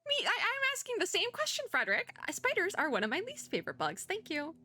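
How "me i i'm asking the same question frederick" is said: distressed complaint. Real, not acted. Player asking the book about spider monsters, whining in distress. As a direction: wail the complaint, pitch jumping up.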